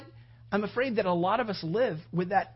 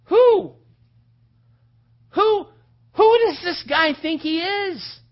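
high, loud, spaced-out outrage; outraged David; 10 semitones higher, 9 dB louder, slower than usual. Acted. Young shepherd challenging the giant's arrogance, outraged shouting. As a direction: hurl each question out in indignation.

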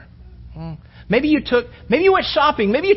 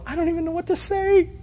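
put-on higher, softer nervous girl; girl's voice; 7 semitones higher, 4 dB quieter than usual. Preacher voicing the girl on the phone, higher and unsure. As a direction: lift into a flustered teenage girl. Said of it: "she's on the other end".

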